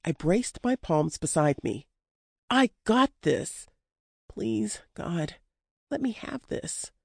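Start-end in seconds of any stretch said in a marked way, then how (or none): 2.5-3.7 strained insistence. A narrator's reading. Pleading with Shaw mid-fight. 4.3-6.9 higher, soft desperate plea. A narrator's reading. Pleading with Shaw mid-fight.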